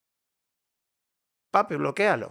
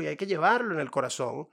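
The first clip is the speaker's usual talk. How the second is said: ordinary talk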